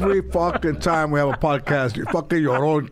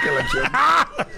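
laughing; 11 semitones higher than usual. Real, not acted. Laughing exclamation.

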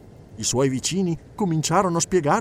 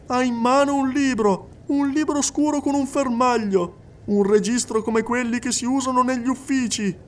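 high, insistent clairvoyant addressing the hall; a medium coaxing a silent widow; medium's voice; 9 semitones higher than usual. Acted. Medium speaking to the crowded séance hall, high and insistent.

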